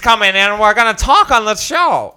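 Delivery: put-on old-timey announcer. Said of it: "You talked like old-timey ... sideshow announcers".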